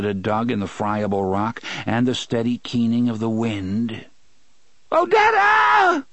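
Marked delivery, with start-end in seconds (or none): 4.9-6.0 cracking full-throat scream. A narrator's reading. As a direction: scream so hard the voice breaks. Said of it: "he screamed so loudly his voice broke".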